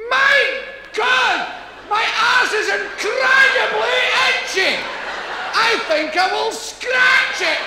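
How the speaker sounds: loud, high proud announcement, words stretched; proud, happy. Acted. Comic telling the crowd how to own up, proud and happy. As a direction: declare it happily at full volume. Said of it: "say in a proud, happy voice".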